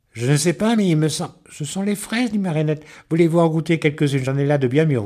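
little Marinette offering strawberries, bright and eager; little girl voice. A narrator's reading. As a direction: offer it like a keen small girl.

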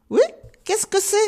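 high-pitched call from the treetop; answering cry. A narrator's reading. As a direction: call back down, a little put out. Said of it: "cria une voix en haut de l'arbre". (cri aigu du haut de l'arbre)

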